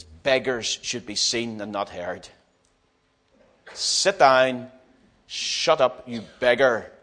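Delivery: low, measured put-down; disapproving polite people. Acted. Polite people silencing a blind beggar, stern disapproval. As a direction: rebuke him with restrained contempt. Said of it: "the polite people all around says".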